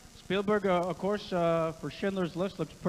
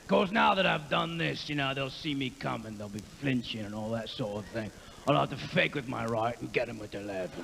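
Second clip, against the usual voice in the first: [name]; 2 semitones lower than usual. Acted. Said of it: "this is, uh, [name]".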